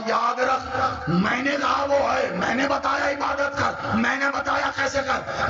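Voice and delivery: high, slow, chanted sermon cry; preaching at full force. Acted. Preacher chanting the Prophet and Salman story, full force. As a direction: wail it out over the crowd.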